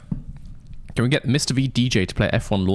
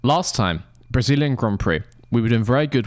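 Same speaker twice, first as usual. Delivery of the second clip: ordinary delivery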